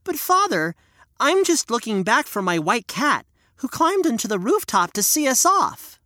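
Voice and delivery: put-on high, pleading little boy; a child explaining himself; young boy voice. Hansel answers his father.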